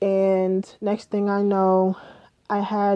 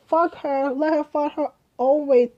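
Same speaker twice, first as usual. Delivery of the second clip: retold fury; 6 semitones higher than usual. Retold fury at jailed friend.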